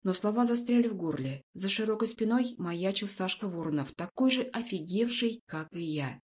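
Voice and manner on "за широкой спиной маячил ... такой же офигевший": ordinary delivery, nothing marked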